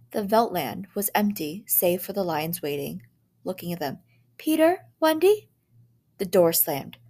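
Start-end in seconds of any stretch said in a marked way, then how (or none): 4.4-5.5 high, loud call. A narrator's reading. Parents search calling for their kids, loud. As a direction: call through the house.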